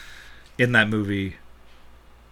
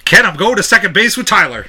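mock announcement; 8 semitones higher, 8 dB louder than usual. Joking announcement to a friend.

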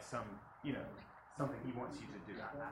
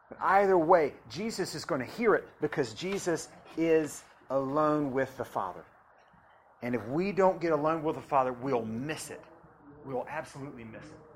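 preaching at full force; 3 semitones higher, 10 dB louder than usual. Acted.

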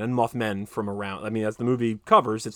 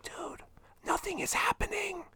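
hushed theater whisper to a friend; 9 dB quieter than usual. Retold or imitated. Leaning over in the theater, whispering. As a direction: whisper it so nobody in the seats hears.

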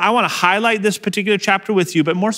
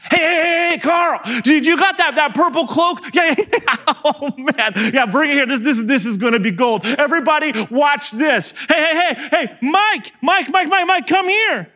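jeering soldier; 9 semitones higher than usual. Acted. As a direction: whip up the crowd like a cocky soldier. Soldiers fetching purple cloak.

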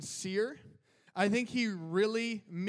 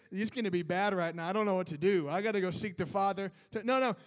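normal talking voice

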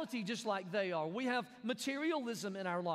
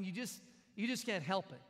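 usual speaking voice

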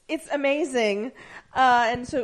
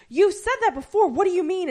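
surprised; 8 semitones higher, 6 dB louder than usual. Retold or imitated. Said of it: "she said something like".

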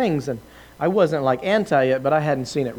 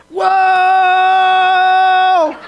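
high shouted whoa of shock; startled whoa; 9 dB louder than usual. Retold or imitated. Preacher reliving being struck, shouting whoa. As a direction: cry out as the weight hits. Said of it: "and I go".

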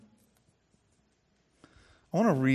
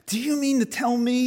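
put-on high, indignant young angel; disgusted little angel; 12 semitones higher, 9 dB louder than usual. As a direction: sneer it in disbelief. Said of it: "he said".

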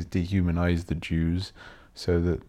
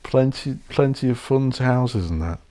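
ordinary delivery

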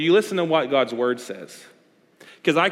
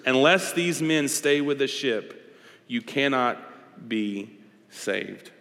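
very slow, spaced-out scripture reading; grave, deliberate pronouncement; solemn warning; much slower than usual. Acted. Reads Paul's warning with weight.

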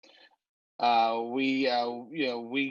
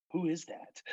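mock bewilderment, higher and softer; confused; 5 semitones higher, 5 dB quieter than usual. Susan asks Kimberly about Su Yung, mock confusion. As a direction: play baffled in a small raised voice. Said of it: "and then Susan's very confused".

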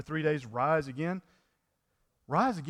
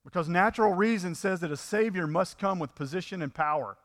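ordinary talk, nothing marked

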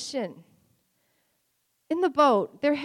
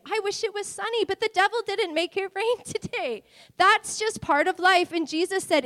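usual speaking voice

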